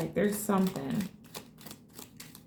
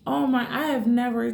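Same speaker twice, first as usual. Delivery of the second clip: ordinary talk, nothing marked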